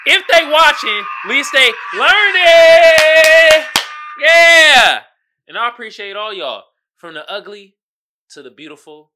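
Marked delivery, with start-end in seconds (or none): 0.1-5.0 loud, high, stretched hype chant; triumphant hype holler; shouted catchphrase. Pretend. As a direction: belt it out, dragging the last word.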